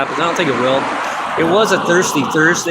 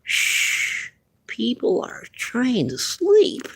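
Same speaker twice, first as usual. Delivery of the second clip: hushed, very slow shushing whisper; quoted hushing whisper; 10 semitones higher, 4 dB quieter, much slower than usual. Retold or imitated. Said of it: "what that person said".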